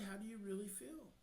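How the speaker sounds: a quiet invitation to open up; really quiet question. Shown as a demonstration. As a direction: drop your voice and ask softly, then wait. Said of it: "get really quiet while she's putting on the happy face".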